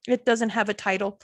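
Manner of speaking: ordinary talk, nothing marked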